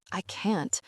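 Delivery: usual speaking voice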